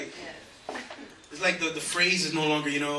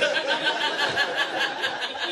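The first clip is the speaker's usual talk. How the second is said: a burst of high laughter at his own joke; 10 semitones higher than usual. Real, not acted. Comic laughing at his own funeral story.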